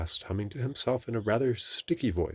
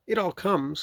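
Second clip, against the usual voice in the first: high-voiced stern rabbit; 12 semitones higher, 5 dB louder than usual. A narrator's reading. Rabbit lecturing stuck Pooh, stern. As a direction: reprove in a high character voice. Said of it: "said Rabbit sternly".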